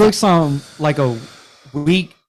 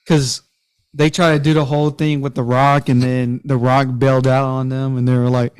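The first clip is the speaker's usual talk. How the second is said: ordinary talk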